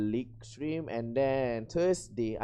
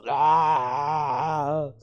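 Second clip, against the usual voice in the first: loud, drawn-out rock cry; rock-style "aah"; 6 dB louder than usual. Shown as a demonstration. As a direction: let out a loud rocker's yell. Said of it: "voice just go rock".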